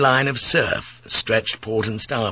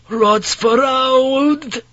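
long, high, drawn-out lookout's cry; wailing scream; 14 semitones higher, 5 dB louder, much slower than usual. A narrator's reading. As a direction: wail the warning out high and loud. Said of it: "he heard the wailing scream again".

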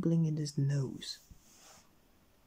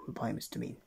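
plain reading voice

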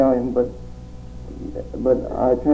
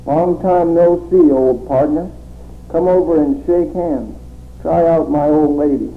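"Long time no see" said higher, slow and louder; poem reading; 4 semitones higher, 4 dB louder, slower than usual. Acted. Poet reading the poem "Long Time No See", declaiming. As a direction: open the poem slowly and high. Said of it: "The first one is called".

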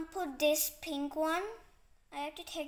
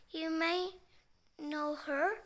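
usual speaking voice

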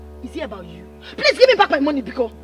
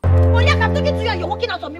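angry confrontation; 7 semitones higher, 3 dB louder than usual. Acted. Creditor confronts woman walking away.

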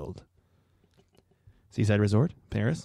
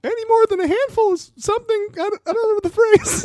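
squeaky voice; 3 dB louder than usual. Retold or imitated. Squeaky voice continued.

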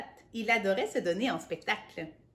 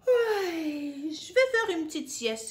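higher, lightly voiced cocky hare; 7 semitones higher than usual. Reading to a child, the hare boasting about a nap. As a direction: toss it off like a smug hare.

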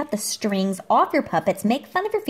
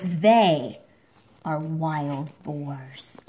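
ominous slow reveal; 6 semitones lower, much slower than usual. A narrator's reading. Reading to a child, spooky reveal of the boars. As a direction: let the reveal hang darkly.

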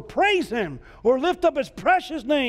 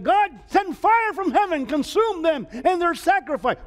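prophet's cry; 9 semitones higher than usual. Acted. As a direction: call down fire with full voice. Elijah calling down fire.

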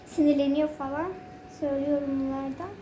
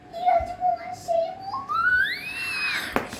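çok tiz, yavaşça uzatılmış sahte ağlamaklı ses; şaka ağlama; much slower than usual. Pretend. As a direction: mutluluktan ağlıyormuş gibi abartarak inle. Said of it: "mutluluktan ağlayacağım". (abartılı yalandan ağlama, çok tiz ve uzun)

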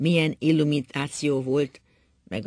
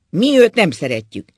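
loud, higher accusation; 5 semitones higher, 13 dB louder than usual. A narrator's reading. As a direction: hurl it at her. Drunk husband's accusation.

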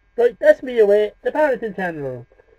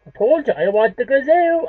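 whiny demand; 6 semitones higher, 4 dB louder than usual. Acted.

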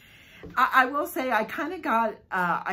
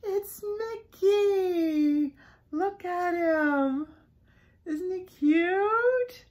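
higher, slow gush over a cute keychain; gushing delight; 7 semitones higher, much slower than usual. Real, not acted. Vlogger showing a Mickey keychain, gushing high. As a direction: coo over the little Mickey.

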